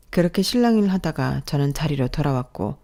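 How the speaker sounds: plain reading voice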